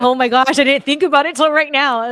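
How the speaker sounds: loud, high burst of real surprise; blurting out a sudden memory; sudden realization. Real, not acted. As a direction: it just hit you this second. Sudden realization mid-story.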